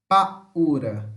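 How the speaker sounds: "paura" split as pa, ura; separated hiatus. Shown as a demonstration. Teacher speaking pausedly so students see the split, separated vowels. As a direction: pause inside "paura" before the u. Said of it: "Eu falei pausadamente pra vocês verem".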